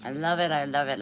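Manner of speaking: ordinary talk, nothing marked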